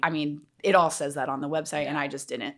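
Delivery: ordinary talk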